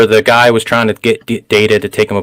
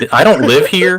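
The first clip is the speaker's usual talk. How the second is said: mock Picard voice; 6 semitones higher than usual. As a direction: put words in Picard's mouth, hammed up. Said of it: "he's like".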